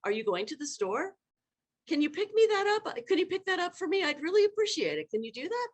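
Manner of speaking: high, lifting tune on every request; rising pleading questions. Shown as a demonstration. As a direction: sweetly ask favours, voice climbing up. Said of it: "Our voice tends to go up".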